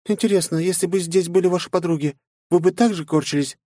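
a gentle AI companion's doubt; Mai voice. Acted. Mai questioning her master.

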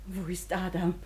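ordinary talk

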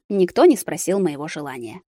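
usual speaking voice

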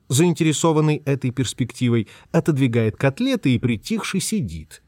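ordinary delivery, nothing marked

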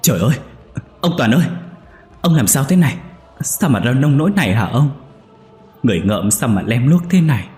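high, loud frantic wife; crying out in alarm over her husband; panicked cry. A narrator's reading. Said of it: "hốt hoảng kêu to". (vợ hốt hoảng kêu to)

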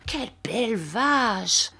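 slow, delighted admiration; admiring exclamation. A narrator's reading. Mère Barberin admires her new cow, delighted. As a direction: savour the cow with pleasure. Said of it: "exclamations de contentement et d'admiration".

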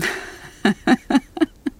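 high, breathy scornful laugh; a sharp scoffing laugh at his kindness; bitter laugh. Acted. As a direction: laugh him off, bitterly amused. Bitter reaction to his offer.

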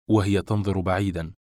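ordinary delivery

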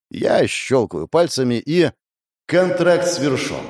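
ordinary delivery, nothing marked

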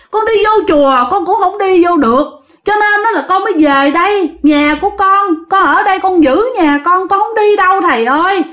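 high, plaintive tale of woe; pleading. Acted. Homeless ghost pleads with the master, plaintive and high. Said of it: "Bắt đầu van xin".

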